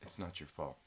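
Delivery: low, soft Good Will Hunting style reassurance; low hushed mock-tender comfort; mock therapist comfort.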